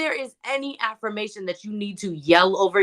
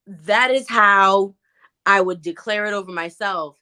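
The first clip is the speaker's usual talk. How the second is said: ordinary talk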